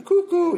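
high, loud two-note "cuckoo"; mock cuckoo call. Recovering drinker mocking his own madness, cuckoo call.

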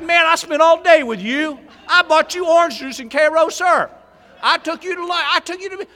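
loud, high-pitched pleading; plead, voice climbing high and loud; begging. Retold or imitated. Sponsor begging the newcomer not to drink, loud high pleading. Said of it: "So I started begging".

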